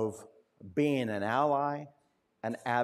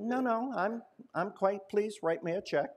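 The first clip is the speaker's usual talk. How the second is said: higher, lightly voiced old lady, polite but firm; a hint of a prim, pleased grandma; 6 semitones higher than usual. Grandma politely insisting to the banker, a touch higher. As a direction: play the sweetly stubborn grandmother.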